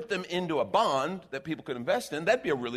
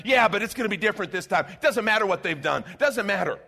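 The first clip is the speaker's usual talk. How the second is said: mock stubborn voter; 5 semitones higher, 6 dB louder than usual.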